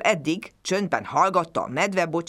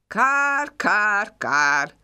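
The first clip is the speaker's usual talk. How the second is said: crow caw; 4 semitones higher, 4 dB louder, much slower than usual. A narrator's reading. The crow caws.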